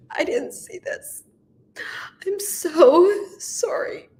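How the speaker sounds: high, drawn-out sorrow, voice giving out; crying, breaking into a whisper; tearful apology. Real, not acted. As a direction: plead sorry through real tears. Weeping apology to Shane.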